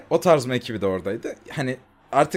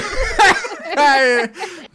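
high rising laugh; 14 semitones higher, 4 dB louder than usual. Real, not acted. Laughing at the thumbnail.

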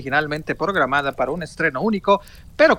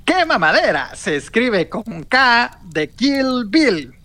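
slow, higher announcer read; slow announcer line; 5 semitones higher, slower than usual. Acted. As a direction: announce the show's spelling grandly. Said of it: "va de nuevo".

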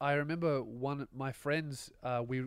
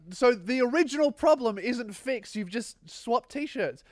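raised, incredulous reasoning; exasperated argument; 9 semitones higher, 5 dB louder than usual. Retold or imitated. Friend objecting to the T-shirt disguise, exasperated argument. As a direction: spell out the flaw, high and slowing down. Arguing against friend's plan.

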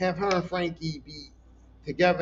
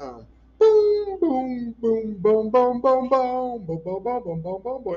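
beatboxing the song; 6 semitones higher, 5 dB louder, much slower than usual. Retold or imitated. Imitating the song's beat.

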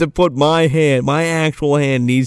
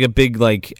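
normal talking voice

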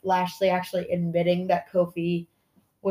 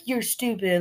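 ordinary talk

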